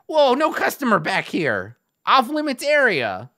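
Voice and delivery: high, loud goblin halt; a merchant blocking the way, loud. Retold or imitated. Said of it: "one of the merchants is like".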